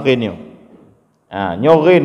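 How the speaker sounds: usual speaking voice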